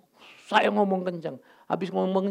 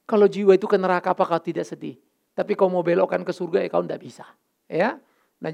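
usual speaking voice

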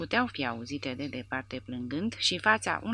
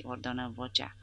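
plain reading voice

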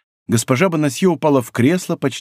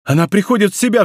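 high, excited exclamation; relieved cry; 6 semitones higher, faster than usual. A narrator's reading. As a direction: cry out in sudden hope. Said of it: "вскричал молодой человек".

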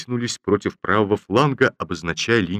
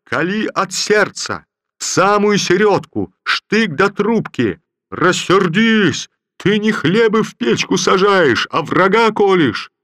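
slow, very high and loud bellow; furious drill shout; 11 semitones higher, 6 dB louder, slower than usual. Acted. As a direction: roar instructions at the bayonet drill. General shouting drill instructions.

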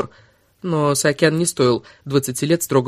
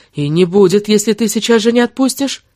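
rising, higher-pitched ultimatum; angry threat; 6 semitones higher, 4 dB louder than usual. Acted. Woman threatening the lover who holds her, heated. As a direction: build the threat as the pitch climbs. She threatens him to let go.

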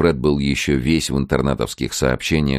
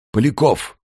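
lightly lowered copy of Mika's voice; imitating Mika; 11 semitones higher than usual. A narrator's reading. Alfred posing as Mika on the phone, lightly lowered voice. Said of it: "отрекомендовался голосом Мики".

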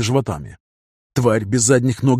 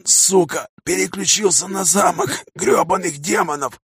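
higher, strained, angry rasp; bitter dying croak; 6 semitones higher, slower than usual. A narrator's reading. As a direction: curse him with failing breath. Умирающий ангел проклинает Каина. (хриплый злой умирающий голос)

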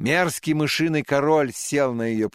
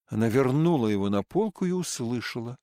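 ordinary delivery, nothing marked

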